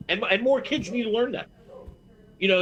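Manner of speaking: normal talking voice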